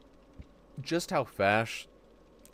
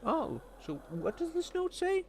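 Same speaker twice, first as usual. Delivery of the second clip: very high, soft, innocent questioning; naive AI; 5 dB quieter than usual. Retold or imitated. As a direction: sound like a clueless eager assistant. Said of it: "where it's like".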